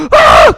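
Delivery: high startled shriek; mock frightened scream. Teacher acting out a sudden fright, high mock scream. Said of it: "무서울 때".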